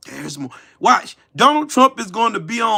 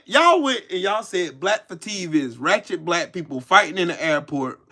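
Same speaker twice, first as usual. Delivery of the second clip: ordinary talk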